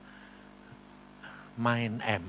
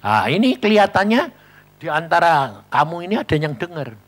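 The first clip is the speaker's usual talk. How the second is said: preaching; 2 semitones higher, 4 dB louder than usual. Acted. Preacher addressing congregation.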